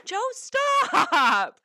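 high-pitched playful protest; laughing protest. Real, not acted. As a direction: squeal it at him, half laughing. Telling husband to stop mid-question.

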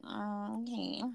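very soft, indifferent acknowledgement; unmoved. Retold or imitated. Woman hearing her boyfriend's pet name, unmoved. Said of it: "didn't feel no type of way".

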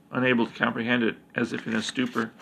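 usual speaking voice

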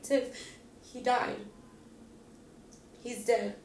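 high, strained retelling of bad news; distraught voice delivering a death; retold tearful news. Breaking bad news, herself upset.

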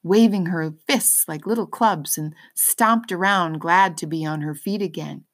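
usual speaking voice

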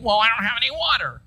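a light grumble, not overdone; whiny complaint. Acted. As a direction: play a sulky complainer with no water. Voicing grumbling Israelites.

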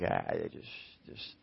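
low, soft brush-off; unbothered, half-voiced mumble; dismissive parent. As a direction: shrug it off under your breath. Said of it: "that's just noise".

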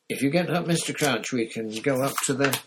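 coaxing Harry. A narrator's reading. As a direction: urge him up gently but firmly.

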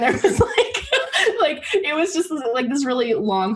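words squeezed out high through real laughter; cracking up mid-sentence; laughing while talking. Real, not acted. Friend recalling Cosby's chocolate cake bit, laughing high.

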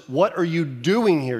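ordinary talk, nothing marked

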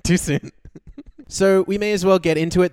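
ordinary delivery

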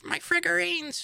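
very high squeaky put-on voice; tiny falsetto mock whine; mock figurine nerd. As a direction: squeal it as a mock precious collector. Mocking the painter of figurines.

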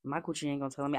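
ordinary talk, nothing marked

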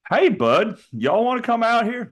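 high-pitched mock Canadian turned Southern; accidental Southern accent. Canada inviting aliens over, slipping into Southern accent. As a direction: call out with a stray Southern twang. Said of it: "why he has a Southern accent now".

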